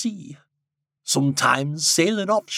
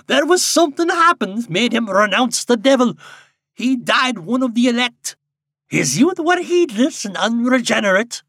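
lightly voiced old villager, higher; a hint of an old storyteller; old villager telling family lore; old local man; 7 semitones higher than usual.

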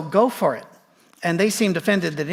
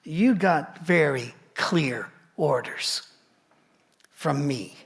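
angel's stern rebuke; 3 semitones lower, 3 dB quieter, slower than usual. Retold or imitated. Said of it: "he says".

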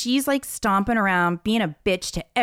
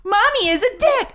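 much higher and louder, shouting "Mommy is a dick"; emboldened kid shout; 14 semitones higher, 5 dB louder than usual. Retold or imitated. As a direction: yell "Mommy is a dick" like the kid just got a laugh. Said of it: "'cause she had that, like, little bit of encouragement".

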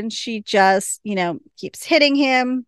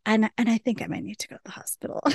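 fast faint whisper; 6 dB quieter, much faster than usual. Retold or imitated. Hurt sheriff telling Wynonna about the hospital, fast whisper. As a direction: breathe it out fast, barely voiced. Nedley weakly admits he's hurt.

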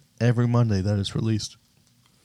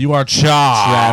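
loud, slow game-show verdict; drawn-out verdict; 3 semitones higher, 7 dB louder, much slower than usual. Pretend. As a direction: call out the verdict, stretching the last word. Game verdict called out in chorus.

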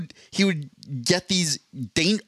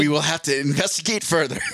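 normal talking voice

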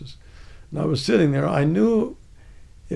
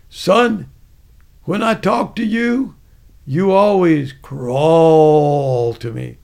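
slow, stretched Southern order at the end; Southern sergeant; 6 dB louder, much slower than usual. Retold or imitated. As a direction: imitate a bellowing Southern sergeant. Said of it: "He spoke with a Southern accent".

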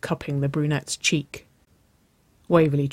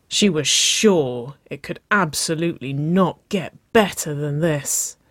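ordinary delivery

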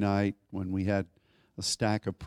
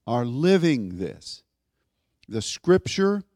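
raised, ringing pulpit voice pressing each point; full-force preaching; 3 semitones higher, 4 dB louder than usual. Acted. Pastor rousing the congregation about God's river, preaching hard. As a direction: preach it out to the whole room.

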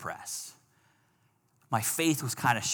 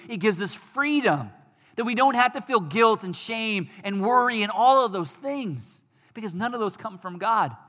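higher, louder full-force preaching; 9 semitones higher, 5 dB louder than usual. Acted. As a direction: preach it out to the congregation.

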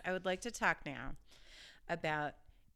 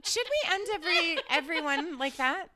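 laughing, high and loud; 9 semitones higher, 8 dB louder than usual. Real, not acted. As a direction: laugh the words out. Laughing at bra joke.

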